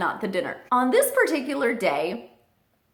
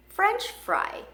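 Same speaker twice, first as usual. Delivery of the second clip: much higher, sing-song coo for the parrot; high coo to the bird; 12 semitones higher than usual. Retold or imitated. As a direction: coax the bird like teaching a word. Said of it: "tells him".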